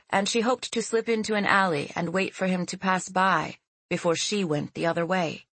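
plain reading voice

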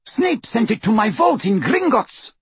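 high, agitated outburst; agitated accusation. A narrator's reading. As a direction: throw it at him, pitch up. Bellatrix interrogates Greyback about sword.

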